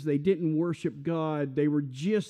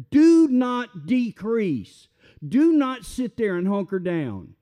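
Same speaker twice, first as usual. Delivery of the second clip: higher and louder, slow on "Do not decrease"; booming preacher command; 5 semitones higher, 7 dB louder, slower than usual. Acted. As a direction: preach it hard, pound each word.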